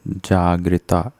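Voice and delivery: जागृता drawn out as "jaa-gri-taa"; slow जागृता with long final aa; slow demonstration. Shown as a demonstration. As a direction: say जागृता slowly, syllable by syllable. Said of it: "होता है".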